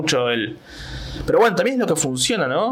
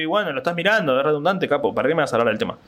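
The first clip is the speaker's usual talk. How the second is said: normal talking voice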